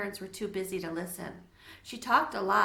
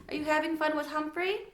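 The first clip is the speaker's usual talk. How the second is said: lightly voiced mother; 7 semitones higher than usual. A narrator's reading. Mother asks daughter.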